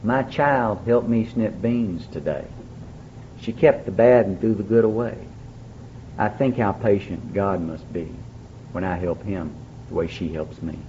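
slow, soft poem recital; reciting a poem. Shown as a demonstration. A recovering alcoholic recites a poem he heard Betty share, softly and slowly. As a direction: read the lines gently and slowly. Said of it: "a poem that really just sums up".

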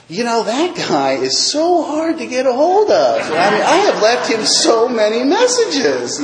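high, slow, overplayed innocent complaint; feigned frustration. Client lying to therapist about unreturned calls, mock exasperated. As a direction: stretch out the lie with hammed-up exasperation.

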